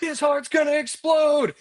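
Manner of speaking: high, loud mock alarm at a reading; doctor mock-horrified at patient's blood pressure. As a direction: shout it like the number terrifies you. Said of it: "I'm like".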